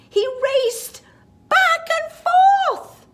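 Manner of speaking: high, loud, stretched out forth; excited build. Acted. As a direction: race up to a big high finish. Racing bunny, building excitement.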